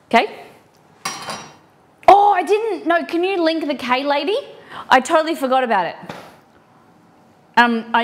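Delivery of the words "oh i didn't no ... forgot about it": much higher, louder burst of remembering; sudden realization. Real, not acted. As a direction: blurt it out as it hits you.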